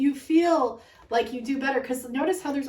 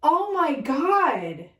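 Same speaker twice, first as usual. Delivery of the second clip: loud, drawn-out overwhelmed disbelief; tearful amazement; 4 semitones higher, 3 dB louder, slower than usual. Real, not acted. Reader in tears at a huge revelation, overwhelmed. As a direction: stretch out each cry of astonishment. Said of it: "I'm in tears right now".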